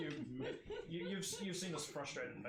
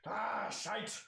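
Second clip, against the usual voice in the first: loud, higher dwarf curse; 7 semitones higher, 6 dB louder than usual. Acted. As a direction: swear it out as the stuck dwarf. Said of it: "and he goes".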